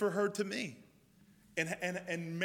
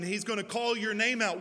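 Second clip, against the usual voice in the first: ordinary talk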